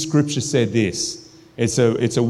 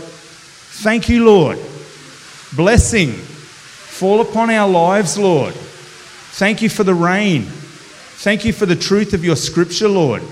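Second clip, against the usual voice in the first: higher, louder fervent praying voice; demo prayer; 8 semitones higher, 4 dB louder than usual. Shown as a demonstration. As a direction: pray out loud with passion. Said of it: "this is before, like".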